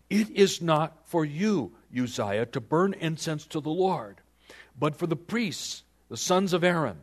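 stern rebuke. Acted. Priests confronting King Uzziah over the incense, stern rebuke.

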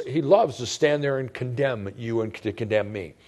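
ordinary delivery

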